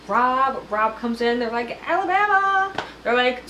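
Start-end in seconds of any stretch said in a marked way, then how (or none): 1.9-2.7 much higher cheer of Alabama; high delighted shout; excited cheer. Retold or imitated. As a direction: greet him with a happy call. Cheering for Rob.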